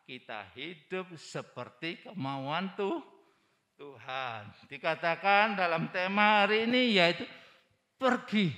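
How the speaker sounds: usual speaking voice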